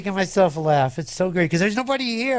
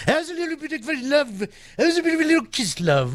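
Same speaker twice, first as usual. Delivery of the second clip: higher, fast game-show host gush; Richard Dawson imitation; 7 semitones higher, faster than usual. DJ doing a game-show host for a sponsor, higher and fast. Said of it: "my Richard Dawson imitation".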